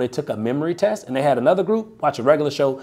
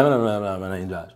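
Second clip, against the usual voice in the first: mumbling; 7 semitones lower than usual. Retold or imitated. Sales rep mumbling his name to a confused homeowner. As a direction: swallow the words "Blah, blah, blah, blah, my name Josh" into a low mumble. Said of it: "He mumbled to him one more time".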